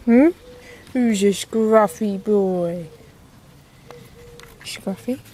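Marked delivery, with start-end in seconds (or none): none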